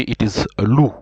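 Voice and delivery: ordinary delivery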